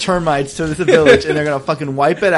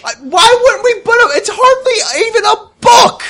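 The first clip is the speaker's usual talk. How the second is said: very high, indignant squawk; hammed-up scorn, pitch way up; scornful outburst; 4 dB louder than usual. Player scorning the merchant's worthless book, outraged.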